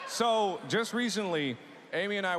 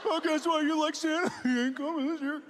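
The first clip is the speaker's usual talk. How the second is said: mock-sweet, higher, sarcastic; 7 semitones higher than usual. Telling little Timmy Santa isn't coming, sarcastic. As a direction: coo it falsely to the child. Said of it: "sarcastic like that".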